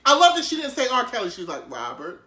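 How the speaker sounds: gently imitated calm interviewer; calm soothing. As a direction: soothe him like a nurse with a patient. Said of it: "she was on her best Shepherd Pratt".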